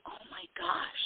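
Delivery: whispered oh-my-gosh to oneself; breathy, stunned whisper; hushed dismay. Retold or imitated. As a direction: gasp it under your breath, aghast. Retelling panicked self-talk on booking mistake.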